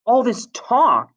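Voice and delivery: high, loud, slow shout; angry shout. A narrator's reading. Ralph shouts back at Jack, high exasperated shout. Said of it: "shouted Ralph".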